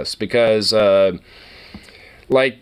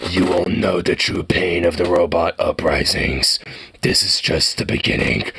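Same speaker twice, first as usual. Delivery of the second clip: ominous robot voice; 7 semitones lower than usual. Retold or imitated. Robot voicing an ominous warning.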